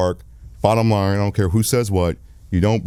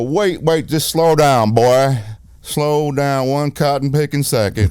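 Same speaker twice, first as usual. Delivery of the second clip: a hint of a drawling Southern lawman; 5 semitones higher, 3 dB louder, slower than usual. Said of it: "It was exactly like that".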